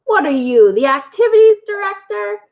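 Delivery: a hint of a cranky old hen; squawking aunt. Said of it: "Aunt Liz squawked".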